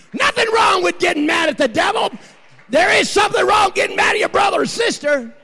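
high, loud, roaring sermon delivery; full-force preaching. Acted. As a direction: preach it to the rafters.

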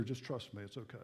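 very soft, low reassuring hush; God's hushed reassurance; soft whisper of God. Acted. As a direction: breathe it gently into his ear. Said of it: "God whispering in his ear".

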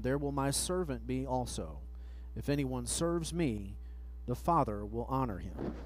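ordinary talk

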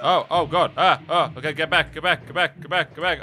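loud panicked yelps of get back; startled loud cries, backing away; panicked yelling. Real, not acted. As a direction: yell at the bug to get back. Panicked by a bug.

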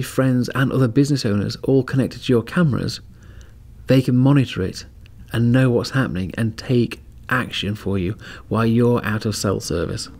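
normal talking voice

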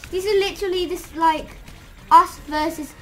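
can't believe what the game just did; outraged disbelief. Real, not acted. Outraged at enemy zombie team.

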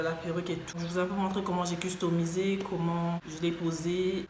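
usual speaking voice

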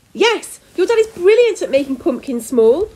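high, loud reassurance; anxious cry. A narrator's reading. Mother backs up the rescue plan, crying out. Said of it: "Prune cried".

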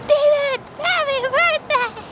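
very high, drawn-out happy birthday; sing-song it up high like a surprise; shrill-pitched mock greeting; squeaky high birthday greeting. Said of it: "I wanna go visit him and be like".

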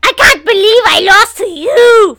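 high, loud cry of disbelief; outraged shout. Real, not acted. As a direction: yell it at the winner, outraged. Losing to Joe in the game.

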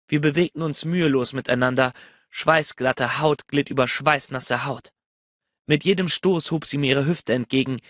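usual speaking voice

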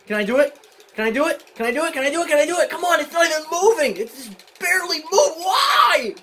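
real panic building to a frustrated wail; rising desperation. Real, not acted. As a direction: beg the game, climbing in pitch to a wail. Mashing buttons, opponent won't budge.

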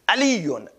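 علیون with the nominative tanween, ending "-on". Shown as a demonstration. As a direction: close علیون on a clear "on" sound. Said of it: "تنوین رفع یعنی اون".

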